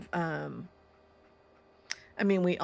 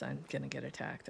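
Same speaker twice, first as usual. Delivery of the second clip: ordinary talk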